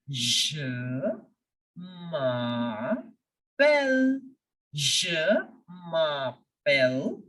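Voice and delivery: drawn-out, low "Je", pause, "m'appelle"; syllable-by-syllable demonstration. Shown as a demonstration. Teacher showing learners how to say it, slowly in pieces. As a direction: stretch each French syllable, long gaps between. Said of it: "I will say it as".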